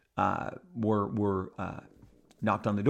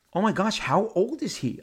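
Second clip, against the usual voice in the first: amazed; 7 semitones higher than usual. Acted. Wife asks me after Shatner leaves, amazed. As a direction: imitate an incredulous question. Wife's amazed question acted.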